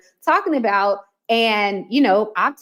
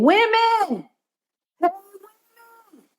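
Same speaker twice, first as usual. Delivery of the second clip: timid call into the void; 9 semitones higher than usual. Retold or imitated. Marketer calling vaguely to everyone in the market. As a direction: call out hesitantly to nobody. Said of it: "that's basically what you're saying".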